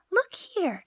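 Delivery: high, small voice; tiny Tom Thumb voice. Acted. Tom Thumb explains his plan, high and small. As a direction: pipe it up.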